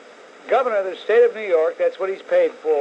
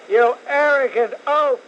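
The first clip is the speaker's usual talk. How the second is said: higher, louder scornful repeat; angrier insult; 7 semitones higher, 5 dB louder than usual. Real, not acted. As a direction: hammer the insult home.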